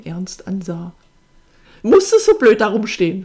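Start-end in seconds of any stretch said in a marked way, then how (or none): 1.8-3.2 loud, irritated outburst; angry snarl. A narrator's reading. Sister lashing out at her brother standing there, angry snarl. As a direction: snap it at him, very loud. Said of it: "fauchte sie ihn an".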